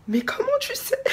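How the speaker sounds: put-on very high astonished child; shocked kid. The shocked boy asking how she knows, very high squeal. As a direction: squeal it like a stunned little boy.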